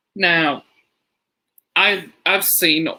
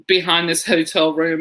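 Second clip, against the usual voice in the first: usual speaking voice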